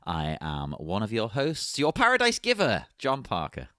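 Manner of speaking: grand show-opening announcer read. Acted. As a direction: hail the citizens of Gotham like a herald. Host opens the show.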